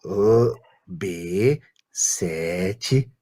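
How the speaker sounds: very slow, low sloth voice; Flash sloth voice. Shown as a demonstration. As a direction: stretch each syllable sleepily. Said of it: "Eu não falo assim normalmente".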